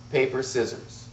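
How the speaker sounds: ordinary delivery, nothing marked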